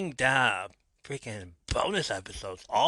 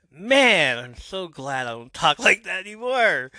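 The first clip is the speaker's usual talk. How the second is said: real embarrassed cringe, pitch jumping high and loud; cringing; 8 semitones higher, 9 dB louder than usual. Real, not acted. Host cringing at his first episode, high and loud.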